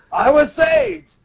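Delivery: high, slow acted cry; dramatic anime outburst; impassioned protest. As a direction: draw out a heated declaration. Law protests to villain.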